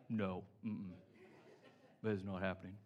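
low, soft grumpy Scrooge voice; husband mocked as a Scrooge refusing Christmas in July; full out Scrooge. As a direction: grumble a flat refusal down low. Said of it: "Full out Scrooge".

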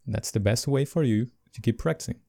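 ordinary delivery

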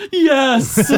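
laughing. Retold or imitated. Homer's blunt answer, laughing.

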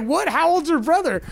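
shocked doctor. Retold or imitated. Doctor reacting to the mom's answer, mock shock. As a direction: play the doctor stunned.

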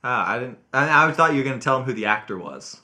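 usual speaking voice

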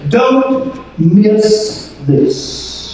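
very slow, spaced-out words; emphatic warning. Acted. Preacher spelling out behold to the congregation, slow emphatic warning. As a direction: draw out each word to make it land. Glossing behold as urgent alert.

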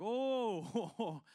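loud, high drawn-out "oh" of recoil; mock revulsion. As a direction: recoil as if hit by a stench. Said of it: "we're afraid he'd be like".

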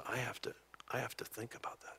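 whispered in awe, barely voiced. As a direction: breathe it out as if stunned. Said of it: "He said".

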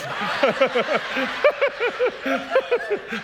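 big laugh. Real, not acted. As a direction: let a real laugh break out. Laughs at own joke.

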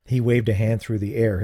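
ordinary delivery, nothing marked

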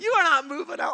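loud, high mock-stern put-down; amused firm no. Youth pastor shutting down teen's plan, loud and amused. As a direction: laugh off the kid's plan.

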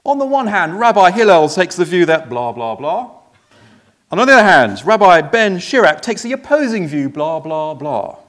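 loud, singsong parody of rabbis debating; mock sermonizing. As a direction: rattle off opposing views in exaggerated oratory.

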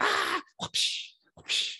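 high whooshing whip crack; whip sound effect. Retold or imitated. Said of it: "That's the whip sound".

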